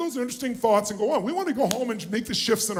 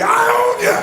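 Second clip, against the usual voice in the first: much higher, loud, intense shout; more intensity; 12 semitones higher, 7 dB louder than usual. Shown as a demonstration. Pushing the crowd harder, intense shout. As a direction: throw it at them with full force. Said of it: "say it with more intensity".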